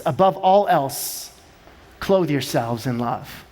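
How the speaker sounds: hushed scripture quote; solemn scripture reading. Real, not acted. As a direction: read the verse with reverence. Scripture quote read slowly.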